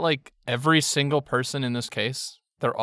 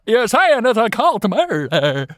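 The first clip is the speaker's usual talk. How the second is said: mock spooky voice; 9 semitones higher, 5 dB louder, slower than usual. High far voice.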